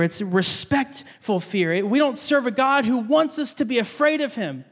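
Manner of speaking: ordinary talk, nothing marked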